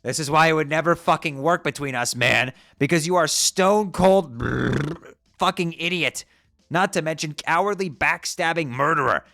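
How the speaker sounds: loud high-pitched contempt; angry tirade. Acted. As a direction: spit every insult. Angry rant at rival.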